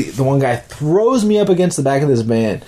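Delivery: ordinary delivery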